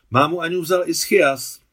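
high, loud bright chirp of news. A narrator's reading. Naďa chirping the news to Alexandra from the door, high and loud. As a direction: chirp it out in a rush. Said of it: "zašvitořila Naďa od dveří".

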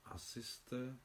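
quiet trailing repeat; fading to a near hush. A narrator's reading. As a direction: let the words die out on jste. Narrator repeats phrase, fading.

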